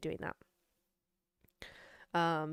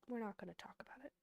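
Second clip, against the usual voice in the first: fast whisper; whispered and rushed to drop the subject; embarrassed whisper; 15 dB quieter, faster than usual. Real, not acted. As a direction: hurry it out in a whisper. Embarrassed, hushing the topic.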